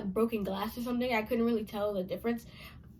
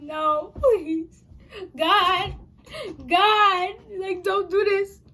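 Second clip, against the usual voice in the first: frantic plea; 8 semitones higher, 7 dB louder, much slower than usual. Retold or imitated. Dragged out by the arm.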